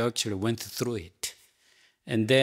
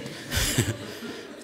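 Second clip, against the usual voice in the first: breathy soft chuckle; soft laugh; 5 dB quieter than usual. Real, not acted. Teacher laughing softly at student's kind offer. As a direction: laugh gently at the kindness.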